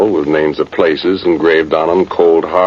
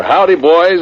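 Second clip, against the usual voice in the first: cheerful holler, higher and louder; grinning holler; 13 semitones higher, 3 dB louder than usual. Retold or imitated. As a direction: call out a friendly hello with a grin. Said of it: "he'll grin at us and holler".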